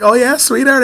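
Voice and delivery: high-pitched, mock-casual reply; feigned nonchalance. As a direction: play it cool to a child. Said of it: "trying to blow this off".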